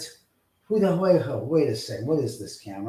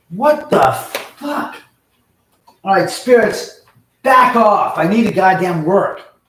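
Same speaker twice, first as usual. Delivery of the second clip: loud, higher furious yelling; angry shouting; 8 semitones higher, 11 dB louder, slower than usual. Real, not acted. Streamer yells at the spirits wrecking his stream, furious shout. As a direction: yell at the invisible culprits to back off.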